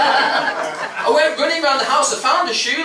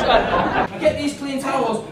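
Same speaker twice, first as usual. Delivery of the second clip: ordinary talk, nothing marked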